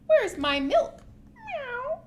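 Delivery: high cat squeak on the meow. Acted. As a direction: ask for milk like a hungry cat. Cat asks for milk.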